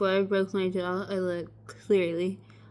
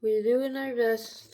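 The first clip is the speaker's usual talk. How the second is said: normal talking voice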